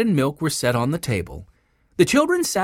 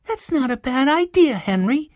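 put-on high, girlish approval; high-pitched, mildly excited; pleased girl's cry; 11 semitones higher than usual. A narrator's reading. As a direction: exclaim it like a young girl. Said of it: "cried Violet".

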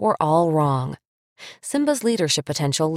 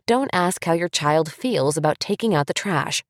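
ordinary talk

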